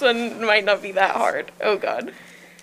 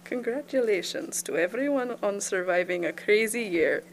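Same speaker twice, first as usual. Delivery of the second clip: quick, badly faked German accent; 2 semitones lower than usual. Congratulating everyone in a weak attempt at a German accent. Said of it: "Oh, German. Even worse".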